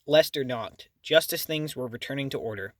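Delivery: usual speaking voice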